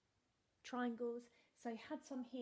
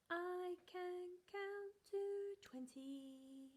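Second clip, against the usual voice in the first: higher, bouncy counting for little ones; sing-song counting; 7 semitones higher, slower than usual. Acted. Teacher leading children in counting and actions, sing-song. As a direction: lead the class in a counting game.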